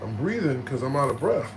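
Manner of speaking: usual speaking voice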